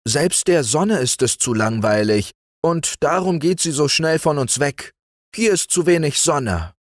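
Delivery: high, lightly whined grievance; bored boy's lament; whining complaint. A narrator's reading. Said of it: "jammerte er".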